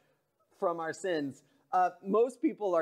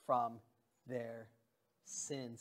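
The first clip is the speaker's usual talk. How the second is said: quiet, low and solemn; voice of God; 5 semitones lower, 11 dB quieter than usual. Pretend. Pastor quoting God softly, solemn and hushed. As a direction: speak God's words gently and gravely. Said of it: "God says".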